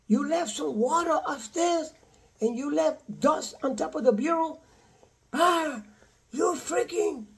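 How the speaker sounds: high, hushed mocking voice of his cursing ex-wife; mimicking the ex-wife. Man mimics his ex-wife cursing him, high hushed mock voice. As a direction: squeeze the insults out high and soft like a shrill caricature. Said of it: "I said, What happened?".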